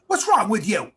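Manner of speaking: loud imitation of a scolding mom; mock motherly scolding. Mother confronting her pitying son, loud mock scolding. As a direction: snap at the boy like a cross mother.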